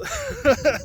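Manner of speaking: high burst of laughter; excited laugh. Real, not acted. Excited joining the team.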